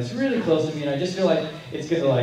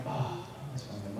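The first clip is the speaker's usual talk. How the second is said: soft pity; 9 semitones lower, 16 dB quieter than usual. Retold or imitated. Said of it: "And I'm like".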